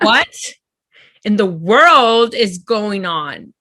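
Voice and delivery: hammed-up shocked what; mock outrage. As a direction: shriek the first word in disbelief, then slow down. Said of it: "I was like".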